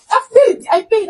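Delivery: desperate retold cry; frantic insistence. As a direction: shout it as if pleading for your life. Insisting she paid.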